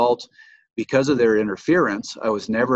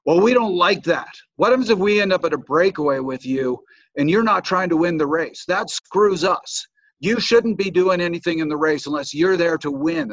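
higher, louder imitated scolding; mock angry lecture; 7 semitones higher, 5 dB louder than usual. Angry rival scolds him for working for teammates, mock angry. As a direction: play the rival racer telling him off. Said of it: "furious with me".

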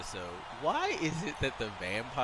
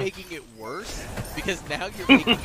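higher, faster, building louder rant; exasperated scolding; 6 semitones higher, 5 dB louder than usual. Retold or imitated. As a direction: lose patience with him. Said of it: "And I'm like".

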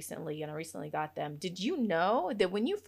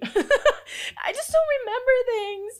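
high, loud and slow through laughter; squealed, laughing self-mockery; laughing exasperation; 8 dB louder, slower than usual. Real, not acted. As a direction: burst out laughing at your own failing. Laughs at her own bad memory.

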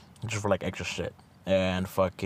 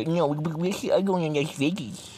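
higher-pitched, sing-song insistence; high mock friend voice; 9 semitones higher than usual. Friend keeps teasing him about Vegas in a high mocking voice.

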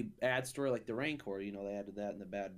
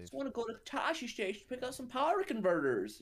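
whiny voice; 13 semitones higher, 3 dB louder than usual. Retold or imitated. Mocking whiny Luke quote.

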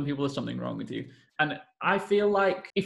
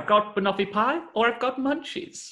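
higher, airy mock of the character's line; mocking character quote; 7 semitones higher than usual. Mocking the TV character's line to her friend, a light parody voice. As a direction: lightly send up how she says it. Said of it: "When she's like".

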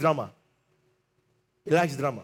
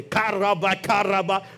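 speaking in tongues. Shown as a demonstration. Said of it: "You speak in tongues".

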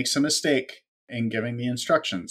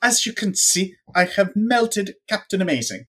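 higher smug villain; 9 semitones higher than usual. Retold or imitated. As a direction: announce it like a proud villain. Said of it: "He's like".

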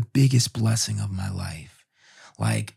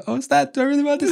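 much higher and fast, flustered nerves; panicked doubt; much faster than usual. Retold or imitated. Father at the birth suddenly panicking, high fast squeak. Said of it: "I remember in a minute being like".

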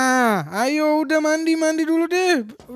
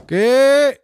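calling out; 6 semitones higher, 7 dB louder, much slower than usual. Acted. Calling friend to wake up.

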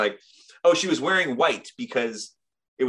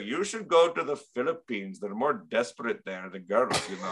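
imitated Indian accent; Raj. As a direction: give sly advice in a mock Indian accent. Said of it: "that accent has been canceled".

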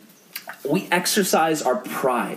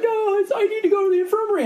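put-on fast, high-pitched kid protest; sixth-grade boy; faster than usual. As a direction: whine it like an insistent little boy. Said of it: "this little sixth grade guy".

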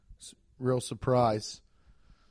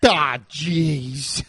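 lightly voiced old-time factory worker; a hint of a worker who botched the job; 3 dB louder than usual. Co-host mimicking someone messing up at the factory, factory worker. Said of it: "something somebody says when they mess up".

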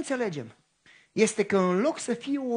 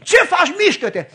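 higher, louder shouted impatience; angry yell; 8 semitones higher, 10 dB louder than usual. Retold or imitated. At the woman blocking the line, angry yell. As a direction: bark it out the window at her.

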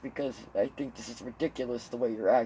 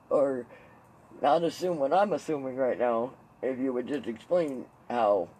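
ordinary delivery

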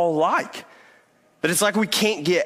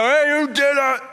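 sharp demand; 10 semitones higher, 4 dB louder than usual. Retold or imitated. Dad confronting son after hitting.

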